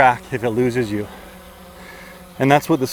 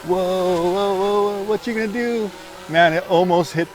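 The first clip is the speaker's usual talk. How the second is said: excited; 7 semitones higher than usual. Real, not acted. Drone swerving near the trees.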